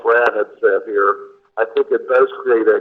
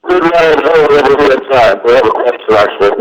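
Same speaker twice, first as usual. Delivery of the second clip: speakerphone; 3 semitones higher, 8 dB louder than usual. Real, not acted. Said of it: "It didn't come through very well on speakerphone".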